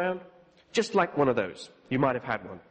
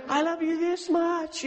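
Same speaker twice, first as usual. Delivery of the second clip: slower, higher loving voice of Jesus; Jesus speaking tenderly; 10 semitones higher, slower than usual. Retold or imitated. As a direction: draw it out with delight. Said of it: "And so".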